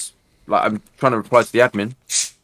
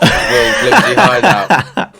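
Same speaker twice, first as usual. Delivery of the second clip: laughing through the name; giggling as he says it; 5 semitones higher, 5 dB louder, much slower than usual. Real, not acted. Laughing while naming friend.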